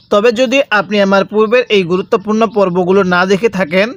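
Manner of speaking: ordinary talk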